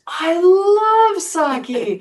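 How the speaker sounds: put-on slow, high, delighted old man exclaiming. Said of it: "And he goes".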